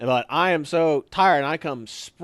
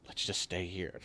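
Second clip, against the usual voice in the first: much softer, lower aside; quiet reply; 8 semitones lower, 15 dB quieter than usual. Retold or imitated. Buddy quietly telling him they should just stay put, much softer. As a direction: murmur the suggestion.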